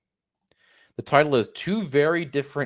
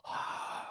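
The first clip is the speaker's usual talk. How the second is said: sharp hammed-up gasp of fright; mock gasp; 15 dB quieter than usual. Mocking the traveller, a hammed-up frightened gasp. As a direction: gasp as if terrified, for laughs.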